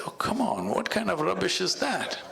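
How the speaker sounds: high, laughing mock disbelief as the pheasant; scoffing pheasant. A narrator's reading. Pheasant scoffing at the bull's dung advice, high and amused. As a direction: scoff at the bull's silly idea, half laughing.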